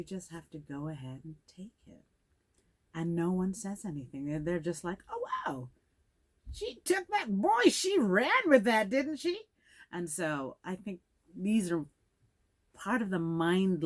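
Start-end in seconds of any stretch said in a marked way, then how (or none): 5.1-9.4 high-pitched, loud onlookers' wow; mock amazement. Onlookers gossip about the one who took it, mock amazement. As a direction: swoop up high, building louder, mimicking the onlookers.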